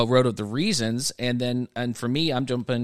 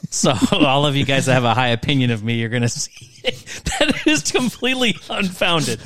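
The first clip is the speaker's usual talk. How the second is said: laughing through it; 2 semitones higher, 3 dB louder than usual. Real, not acted. Laughing at own failure.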